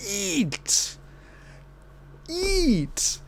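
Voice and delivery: high, strained outraged squeak on eat. Real, not acted. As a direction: leap way up in disbelief. Outraged at babies' milk being stolen.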